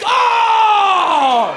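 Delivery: high, very loud drawn-out "oh"; theatrical holler sliding down at the end; long hammed-up shout. Comedian caps his comeback to the bully, long triumphant holler.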